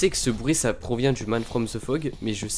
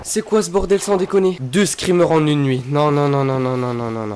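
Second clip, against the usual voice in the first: loud, high shocked outcry; frightened shouting; 6 semitones higher, 7 dB louder than usual. Real, not acted. Second screamer of the night.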